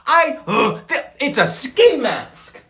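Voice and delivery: dismayed realisation. Real, not acted. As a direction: spell out the ski mask in horror. Realising his beanie is ski mask.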